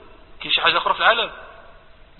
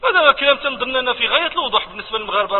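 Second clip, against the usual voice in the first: ordinary talk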